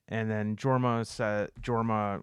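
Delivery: usual speaking voice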